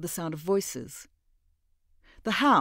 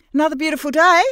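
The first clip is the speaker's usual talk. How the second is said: bright, much higher and louder, friendly; a cheery greeting to cover her nerves; Mira's breezy hello, higher and louder; warmly; 10 semitones higher, 12 dB louder, much faster than usual. Acted. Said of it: "she said warmly".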